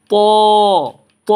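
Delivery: "ত" as a full, rounded too, drawn out; long, thick "ত"; thick heavy letter. Shown as a demonstration. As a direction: hold "ত" with a heavy, full mouth. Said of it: "মোটা করে দিতে হবে".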